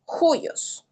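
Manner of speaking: "juyos" starting with a Spanish jota sound; rough breathing J. Shown as a demonstration. Teacher shows students the rough breathing in juyos. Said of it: "se pronuncia como J".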